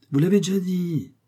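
plain reading voice

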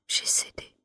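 j'ai cédé breathed out, barely voiced; whispered admission to herself; fading whisper. A narrator's reading. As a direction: let j'ai cédé die away in a whisper. Confession, repeated fainter.

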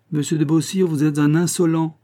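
offended, emphatic reproach; indignant rebuke. A narrator's reading. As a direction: tell them off indignantly. Nicole rebukes him in quarrel.

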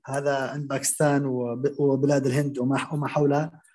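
normal talking voice

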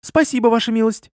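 put-on high, sweet servant-girl voice; cooing. A narrator's reading. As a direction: coo your thanks to his lordship. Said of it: "проворковала". (воркующим голоском)

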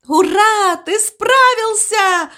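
high, drawn-out hurrah; cheering shout. A narrator's reading. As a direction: cheer loudly and high for a friend. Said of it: "закричали свинки и громко захлопали".

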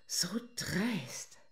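a whispered, admiring reproach; seductive whisper.

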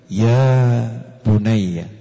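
very slow, low and tender; tender call. Shown as a demonstration. Preacher demonstrates gentle address to a son, very slow. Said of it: "Coba kalau didengarkan tuh".

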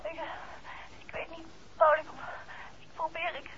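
soft, faltering, broken-off words; lost and scared, barely getting it out; dazed and frightened. Acted. A dazed wife stammering to her husband on the phone.

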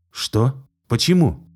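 alarmed double question; surprised. Acted. Nathan turns, alarmed.

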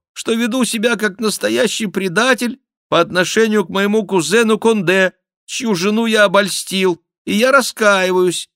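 high, loud penitent voice; put-on voice of a sham king; mock-king confession. A narrator's reading. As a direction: confess loudly, high above your own voice. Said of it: "продолжал мнимый Генрих".

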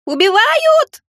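very high, loud alarm cry; bellowing alarm. Acted. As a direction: shriek it as if being murdered. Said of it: "загласила всё та же голова".

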